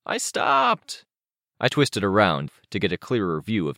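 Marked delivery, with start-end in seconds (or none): 0.1-1.1 put-on very high, mildly raised kid's call. A narrator's reading. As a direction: call it back to the car behind. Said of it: "calling out".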